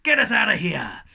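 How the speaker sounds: loud, high shout; captain's shout. A narrator's reading. Captain shouting at his crew to get moving. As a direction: yell it at the crew. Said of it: "shouted Captain Jeggings".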